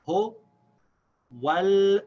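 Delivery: "Hu wal" high and loud, in separate pieces, l held; skipped alif. Shown as a demonstration. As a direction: sound out "Hu wal", wa straight into l. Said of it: "we're skipping this alif".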